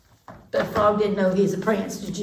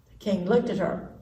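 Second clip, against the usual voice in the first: usual speaking voice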